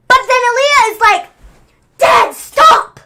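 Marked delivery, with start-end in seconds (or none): 2.0-3.0 high, loud imitated cry; mock pleading shout. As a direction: shriek it like a panicked daughter. Aaliyah pleading retold.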